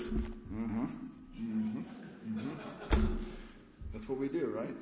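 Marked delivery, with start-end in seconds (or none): 0.0-3.1 absent-minded humming, not really listening; half-listening grunts; distracted mm-hmm. Shown as a demonstration. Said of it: "not like this".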